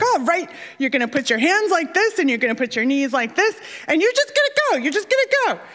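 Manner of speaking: hyped-up coaching dad, pitch leaping at the end; pep-talk a nervous diver, building to high urging. Retold or imitated. Dad coaching daughter on the diving platform, hyped.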